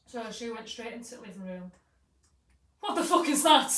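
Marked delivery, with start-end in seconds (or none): 2.8-3.7 high, loud burst of disbelief; acted shocked yell. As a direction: mimic someone walking in on a shock. Retold shock at horse indoors.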